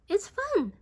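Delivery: put-on high eager girl's voice; Sarah coaxing. A narrator's reading. Sarah urges Beatrice onto the ice, high coaxing voice. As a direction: coax her in, pitched high. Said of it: "said Sarah".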